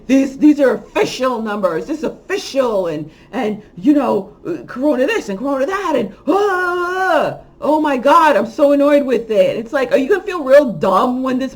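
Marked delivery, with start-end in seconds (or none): 0.1-9.6 hammed-up alarmed voice, higher and louder; mock the panicked influencers; mocking impression.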